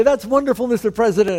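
high, loud mock praise; imitating gushing guest. Oblivious guests greeting the president.

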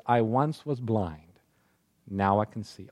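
soft and slow. Acted. Quoting the healed man's testimony.

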